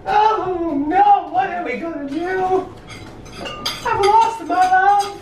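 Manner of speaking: high, slow operatic woe; mock tragic lament. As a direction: wail it like lost love.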